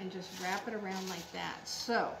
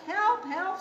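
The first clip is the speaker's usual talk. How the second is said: high, louder storybook scream; 9 semitones higher, 8 dB louder than usual. A narrator's reading. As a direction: cry out for help, hammed up. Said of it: "screamed Mom".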